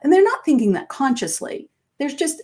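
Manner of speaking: ordinary delivery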